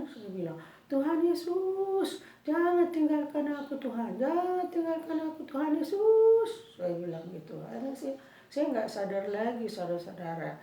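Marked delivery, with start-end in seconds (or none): 0.9-6.7 re-enacted desperate wail to Jesus; crying out. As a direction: beg loudly not to be abandoned. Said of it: "saya berteriak-teriak".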